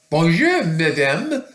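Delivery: high, loud lilting hello; sing-song storybook lion greeting; polite lion greeting. A narrator's reading. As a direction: call out politely with a rising tune.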